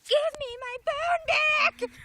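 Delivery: high, slow, very loud ghostly demand; angry ghost shout. A narrator's reading. Ghost losing patience with the woman, angry shout. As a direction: roar it out like an angry spirit. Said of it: "It was beginning to sound angry".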